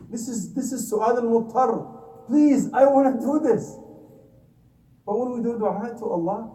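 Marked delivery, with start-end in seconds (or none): 2.3-3.8 higher, louder, slow mock begging; pleading child. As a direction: drag it out like a kid pleading. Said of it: "begging him until we cry".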